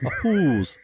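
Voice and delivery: put-on playful Minnesota accent; mimicked Minnesotan hose. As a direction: echo "hose" with a rounded Minnesotan O. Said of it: "a word with a long O in it".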